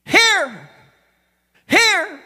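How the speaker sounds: high, loud roll-call shout. Acted. Preacher acts church members answering roll call, shouting. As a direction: holler it out like answering from the back pew. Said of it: "they shouted".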